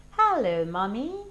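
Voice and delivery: loud, bright group greeting; children's cheerful hello. Acted. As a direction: call out hello to mummy. Children greeting their mother.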